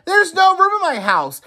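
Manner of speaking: very high panicked plea; panicked Oscar. A narrator's reading. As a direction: cry out in despair. Oscar pleads to wise man.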